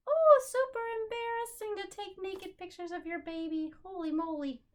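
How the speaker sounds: high, loud playful shock; teacher pretending to be scandalized by baby pictures; mock horror. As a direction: ham up the embarrassment.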